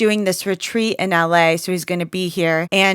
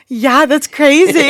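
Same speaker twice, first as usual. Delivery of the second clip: slow, higher mock sympathy; high, drugged sympathy; 7 semitones higher, 5 dB louder than usual. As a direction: fake nodding along. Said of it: "I'm just like".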